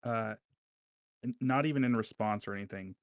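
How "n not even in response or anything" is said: ordinary delivery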